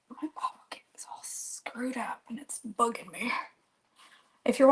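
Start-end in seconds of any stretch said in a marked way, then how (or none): 0.1-3.3 breathy whisper to herself; a grumbling whispered aside. Real, not acted. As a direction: whisper it under your breath, annoyed. Muttered aside about bottle cap.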